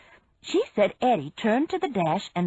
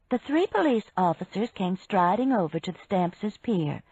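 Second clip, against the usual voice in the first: usual speaking voice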